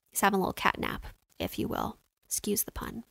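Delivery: whispered aside; whispering. Real, not acted. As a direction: drop to a whisper for the joke. Joking aside about cat nap.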